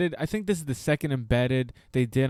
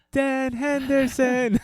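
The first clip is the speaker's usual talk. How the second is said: much higher, slow, faint call from far off; distant child's answer; 11 semitones higher, 4 dB louder, much slower than usual. Retold or imitated. Son answering dad from far away, distant high call. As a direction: call it back from across the field. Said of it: "like, a far distance".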